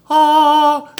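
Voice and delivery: high, loud, held "ahh" of wonder; re-enacted awestruck "ahh"; awed gasp. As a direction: sing out a mock-angelic ahh. Retold childhood awe at dolls.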